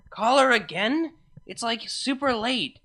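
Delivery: muffled, away from the phone. Acted. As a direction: talk as if off the receiver. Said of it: "Vinyl's voice was muffled".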